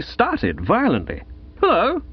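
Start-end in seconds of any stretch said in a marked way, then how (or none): 1.6-2.0 startled high hello; shaken. Acted. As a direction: jump with fright. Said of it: "he said, visibly shaken".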